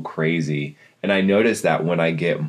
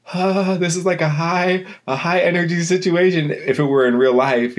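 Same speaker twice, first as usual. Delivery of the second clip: high-pitched, keyed-up inner voice; on edge; 12 semitones higher, 3 dB louder than usual. Retold or imitated. Dreamer among celebrities tells herself, on edge. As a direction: act out being overexcited, pitched well up. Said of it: "like, being on edge".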